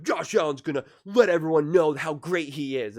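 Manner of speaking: loud, high proclamation; mock fan hype. Acts hype he does not feel.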